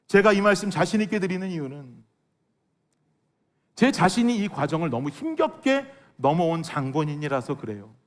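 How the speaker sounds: normal talking voice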